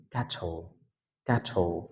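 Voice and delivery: ক্যাটল as "kat-ho", crisp breath after the T; British aspirated T. Shown as a demonstration. Teacher showing how the British say it, aspirated T. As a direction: release the T of ক্যাটল with a puff. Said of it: "ব্রিটিশরা এই ক্যারলকে কীভাবে উচ্চারণ করবে".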